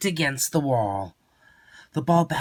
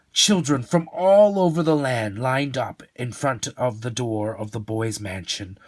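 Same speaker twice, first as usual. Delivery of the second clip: plain reading voice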